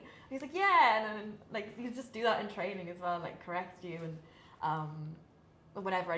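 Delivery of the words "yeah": high, loud, triumphant yeah; hyped approval. Retold or imitated. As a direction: cheer her on like a coach. Coach approves loudly.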